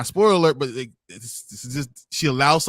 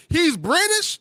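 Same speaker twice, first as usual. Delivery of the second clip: much higher, amazed mock realization; mock surprise; much slower than usual. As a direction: act out the sudden discovery. Surprised realization about Idris Elba.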